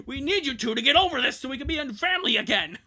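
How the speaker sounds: high, loud and fast parental plea; urgent plea. Acted. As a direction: push through it to hold the family together. Parent pleads with kids.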